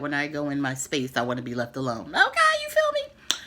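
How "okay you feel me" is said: much higher and louder, put-on sassy voice; sassy aside. Real, not acted. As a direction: toss it off with attitude.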